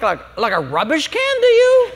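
high, indignant mock challenge; sassy retort. Preacher voicing a retort to a critic, high and indignant. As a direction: fire it back at them.

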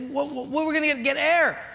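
high, stammered worried question; mock alarm. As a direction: stumble over it, playing up the worry. Worried question before the dive.

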